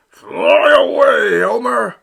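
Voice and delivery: loud, slow, desperate plea; a wounded father begging his son to go; pleading. Acted. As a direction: beg him to fly away at full voice. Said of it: "Papa pleaded".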